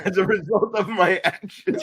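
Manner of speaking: laughing through words. Real, not acted. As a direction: repeat it while laughing. Laughing repeat.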